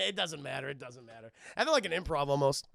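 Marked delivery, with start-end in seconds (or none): none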